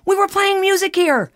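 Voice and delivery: high exasperated cry; indignant outburst. Retold or imitated. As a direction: protest hotly, voice way up. Indignant retort to husband.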